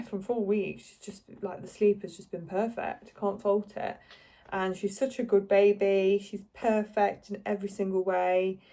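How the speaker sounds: ordinary talk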